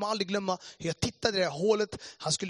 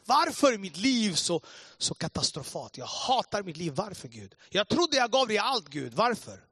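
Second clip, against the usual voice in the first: anguished outcry, loud bursts that drop back; retold desperate cry; 4 semitones higher, 4 dB louder than usual. Broken man asking God why, crying out. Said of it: "jag bara skriker ut bara".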